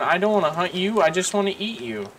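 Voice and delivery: high sweet coaxing, then teasing; coaxing a wild bird. Real, not acted. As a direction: lure it in gently.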